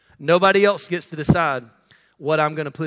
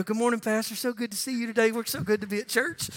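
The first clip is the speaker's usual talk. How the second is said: higher, softer, sugary fake politeness; mock sweet churchgoer; 4 semitones higher, 7 dB quieter than usual. Parent mocks fake pious greeting after yelling at kids. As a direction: put on a falsely pious greeting. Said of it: "you get out and go".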